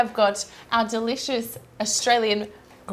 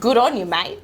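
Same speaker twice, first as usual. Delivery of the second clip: Australian accent; 4 dB louder than usual. Shown as a demonstration. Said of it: "The most Australian way to say it".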